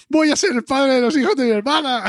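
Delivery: high-pitched mock-excited outburst; imitated excited announcement. Co-host quoting the brother's sister scene from the series, mock excited. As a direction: squeal it as a comic quote, high. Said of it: "en el episodio ese que dice".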